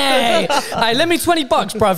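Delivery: high, laughing mock beggar; whiny cheeky plea for cash; begging voice. Mock begging rich friend for cash.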